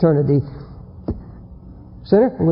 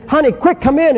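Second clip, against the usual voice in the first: higher-pitched, urgent call to a wife; 7 semitones higher than usual. Acted. Man urgently calling his wife in from the kitchen, urgent.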